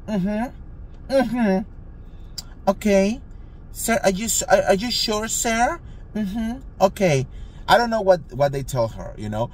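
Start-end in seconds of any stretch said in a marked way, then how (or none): 0.1-7.3 high, meek mm-hmms of a cornered clerk; mock timid clerk. Clerk answering manager on phone, high meek mock voice. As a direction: squeak it out like a nervous receptionist.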